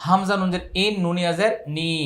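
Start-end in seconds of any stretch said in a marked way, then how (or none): none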